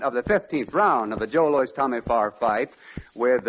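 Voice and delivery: ordinary talk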